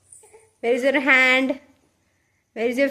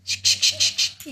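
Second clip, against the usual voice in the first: whispered coo. Real, not acted. Mother soothing the baby with whispered coos.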